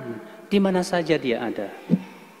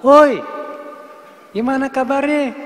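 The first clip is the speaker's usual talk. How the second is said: loud slangy greeting, pitched up; rough call-out; 6 semitones higher, 3 dB louder than usual. Retold or imitated. Preacher mimics a crude street greeting, loud. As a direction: holler across the street like a buddy. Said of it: "Ini ketemu bukan salam".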